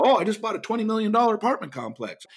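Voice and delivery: breezy offhand boast, a hint of swagger; mock big talker. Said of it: "they talk at big levels".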